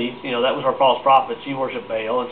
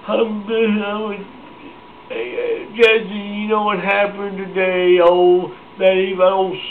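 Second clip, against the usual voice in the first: high, slow crybaby voice, swelling to a loud wail; hammed-up whimper that grows into wailing; Ahab; 5 semitones higher, 4 dB louder, slower than usual. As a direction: imitate a blubbering little baby telling tales. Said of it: "Ahab's a little baby".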